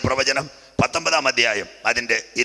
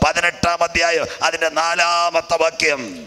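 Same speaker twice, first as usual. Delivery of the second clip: sustained high-pitched sermon voice; fervent preaching; 5 semitones higher than usual. Real, not acted. Preacher sending believers to the book of Revelation, fervent preaching. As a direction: drive the warning home to the congregation.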